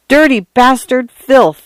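enraged cry mid-fight; angry cry. A narrator's reading. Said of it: "Arthur cried".

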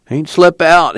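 high, loud sleepy-man's moan. A narrator's reading. Pa just woken by Ma, high loud moan. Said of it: "he moaned".

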